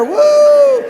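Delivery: shrill party whoop; high whoop. Pretend. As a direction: let out a high celebratory woo. Cheer after countdown.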